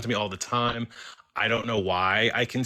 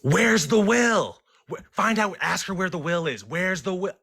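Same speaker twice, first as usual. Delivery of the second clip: frantic yelling; 11 semitones higher than usual. Retold or imitated. Coworker's siblings yelling at the medium, high and frantic. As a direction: yell it like panicked relatives. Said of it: "start freaking out and yelling".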